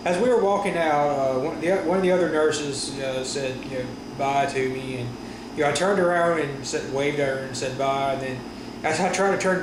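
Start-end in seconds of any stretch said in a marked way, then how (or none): none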